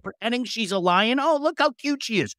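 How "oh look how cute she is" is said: high-pitched, gushing imitation; mock cooing. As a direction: coo over it in a put-on high voice. Imagined cooing at Smurfette lion.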